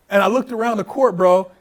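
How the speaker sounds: ordinary talk, nothing marked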